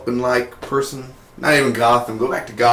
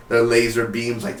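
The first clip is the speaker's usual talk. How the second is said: ordinary delivery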